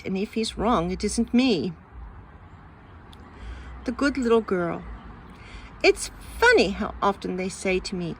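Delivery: plain reading voice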